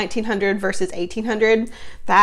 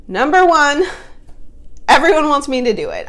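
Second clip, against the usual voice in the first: excited; 4 semitones higher, 6 dB louder than usual. Real, not acted. Excited top pro reveal.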